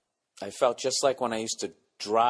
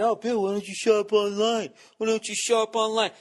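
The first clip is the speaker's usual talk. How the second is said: higher, put-on nagging voice; mock listener; 10 semitones higher than usual. Mocking listeners who say shop online, higher nagging voice. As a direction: parrot the smug listener's advice. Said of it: "And I know what you're thinking".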